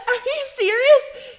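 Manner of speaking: high, laughing disbelief; retold high-pitched incredulous question; incredulous retort. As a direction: squeal it slowly in disbelief. Incredulous quote amid laughter.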